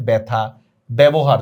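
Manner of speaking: ব্যথা, ব্যবহার as "baetha, baebohar"; open ae sound. Shown as a demonstration. Teacher contrasts the vowel, ব্যথা and ব্যবহার with "ae". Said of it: "এর মতো উচ্চারণ হয়েছে".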